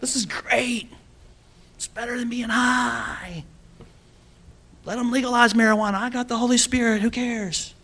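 high, soft, dreamy put-on voice; mock blissed-out believer. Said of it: "go around going".

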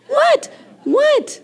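high-pitched "what?" with a laugh; short questions shooting way up high; mock indignant. Preacher teases jealous ladies, mock indignant high questions.

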